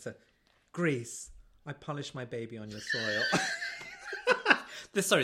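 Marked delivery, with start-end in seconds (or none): 0.7-3.2 mock dramatic reading. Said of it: "Sorry, I'm joking".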